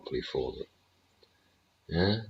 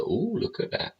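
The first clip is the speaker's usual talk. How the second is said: much higher mock ooh at the price; mock delight. An investor spotting the Bitcoin price, mock-delighted squeal. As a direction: squeal ooh like a thrilled trader.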